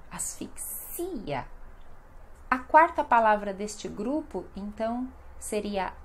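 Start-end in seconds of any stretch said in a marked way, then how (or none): 0.1-1.5 "asfixia" slow and softer, as-fik-SI-a; soft, drawn-out IA in "asfixia"; stress on IA. Shown as a demonstration. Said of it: "o mais forte é o ia".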